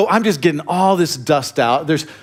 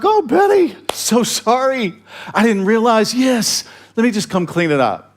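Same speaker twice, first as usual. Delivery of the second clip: eager apology; 7 semitones higher than usual. Retold or imitated. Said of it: "I would've been like".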